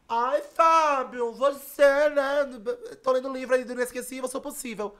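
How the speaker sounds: teasing impression of a smitten voice message; mock self-satisfied flirting. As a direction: mimic someone who thinks the pickup line is killing it. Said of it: "ela achou que tava arrasando".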